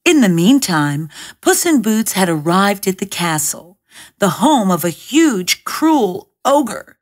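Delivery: ordinary delivery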